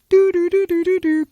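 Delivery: high sung dialing tones; dialing beeps. Pretend. Dialing his friends, beeping tones. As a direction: mimic phone buttons beeping. Phone dialing sound effect.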